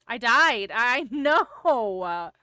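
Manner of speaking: loud laughing. Real, not acted. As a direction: burst out laughing. Laughing at the invisible tree.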